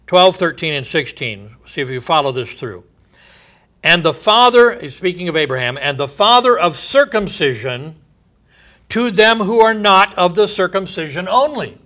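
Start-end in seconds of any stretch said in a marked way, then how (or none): 3.8-11.8 raised, ringing pulpit voice; solemn scripture reading. Acted. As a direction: declaim God's oath from the pulpit. Reading Romans four aloud.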